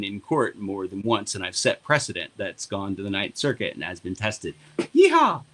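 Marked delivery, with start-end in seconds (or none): 4.8-5.4 excited whoop. Real, not acted. Celebrating court wins.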